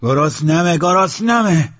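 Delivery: loud, high holler; hungry shout. Acted. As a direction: yell it out, demanding food. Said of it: "داد زد".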